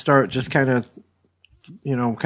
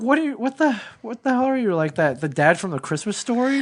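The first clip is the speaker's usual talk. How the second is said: incredulous; 6 semitones higher than usual. Retold or imitated. Mocking friend's printed maps.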